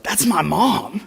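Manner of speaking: high excited cry of realization; excited shout. Retold or imitated. Girl shouting to her friend, high and excited. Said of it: "she screamed out".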